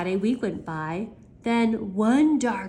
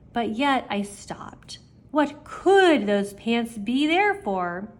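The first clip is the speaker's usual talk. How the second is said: usual speaking voice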